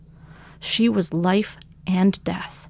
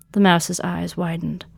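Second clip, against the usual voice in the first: ordinary delivery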